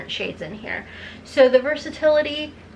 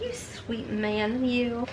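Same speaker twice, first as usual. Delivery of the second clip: slow, soft and choked-up; wife thanking husband, slow, soft and teary; teary tenderness; 8 dB quieter, much slower than usual. Retold or imitated. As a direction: say it slowly and softly, moved. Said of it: "getting all teary-eyed".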